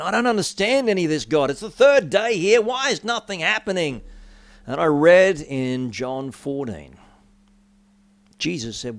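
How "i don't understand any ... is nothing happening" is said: frustrated plea to God. Retold or imitated. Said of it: "I'm going".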